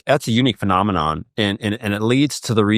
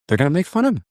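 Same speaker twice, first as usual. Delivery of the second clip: much higher repeat of the warning; emphatic repeat; 8 semitones higher, much faster than usual. Retold or imitated. Friend repeating the warning to the dad, pitched much higher. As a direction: press it home, pitched well up. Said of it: "One of his friends told him".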